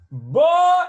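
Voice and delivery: high, loud shout; calling the pet. Acted. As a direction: holler "boy" as a sudden high yelp. Acting out owner calling the cat.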